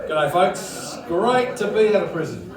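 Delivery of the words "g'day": ordinary talk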